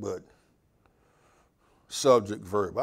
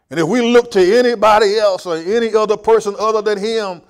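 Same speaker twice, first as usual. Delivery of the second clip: higher, louder preaching voice; full-force preaching; 6 semitones higher, 8 dB louder than usual. Acted. Pastor warns the congregation, preaching higher and louder.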